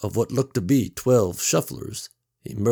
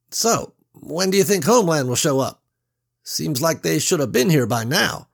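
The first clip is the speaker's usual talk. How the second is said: lighter, higher worried voice; high nervous man; 7 semitones higher, 5 dB louder than usual. A narrator's reading. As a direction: voice an anxious young worker. Reggie's voice in the story.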